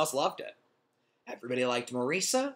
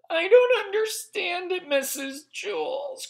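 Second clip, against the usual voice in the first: put-on much higher, loud whiny girl voice; crying girl; 6 dB louder, slower than usual. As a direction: wail it like an upset little girl. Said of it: "cried Morisa".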